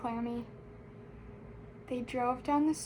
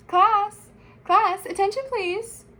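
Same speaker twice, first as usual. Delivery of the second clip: loud, high classroom call; calling to attention; 8 semitones higher, 9 dB louder than usual. A narrator's reading. Principal quieting the class, calling to attention. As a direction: ring it out over a room of pupils.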